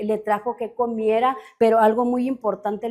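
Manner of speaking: usual speaking voice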